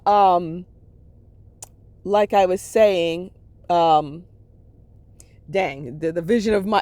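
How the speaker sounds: ordinary delivery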